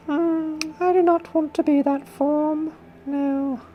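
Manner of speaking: haughty queen, pitched very high. Acted. Porcelain clay talking to the potter like a queen, high queen voice. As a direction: speak in a high, regal queen voice. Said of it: "it's like in a queen voice".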